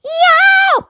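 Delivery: high loud yelp of pain. Acted. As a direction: yelp as if bitten. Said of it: "yelped in pain".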